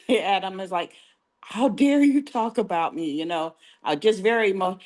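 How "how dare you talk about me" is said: higher, playfully affronted retort; hammed-up huffy protest; mock indignation. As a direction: act offended, as if retelling a huffy comeback. Retold huffy reply after sourpuss look.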